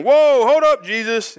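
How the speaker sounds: sudden high, loud objection; grumbling protest. Retold or imitated. Grumbling crowd objecting loudly to Jesus, high and loud. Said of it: "like, grumbling".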